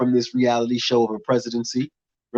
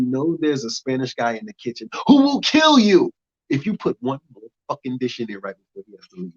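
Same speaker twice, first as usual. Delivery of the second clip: ordinary talk, nothing marked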